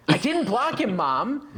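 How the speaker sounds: high-pitched retold protest to mom; exasperated protest. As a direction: plead innocence like an accused son. Retold protest to accusing mother.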